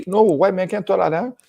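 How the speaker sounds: high, loud insistent denial; mock incredulous retort; mock disbelieving crowd. Doubting people voiced.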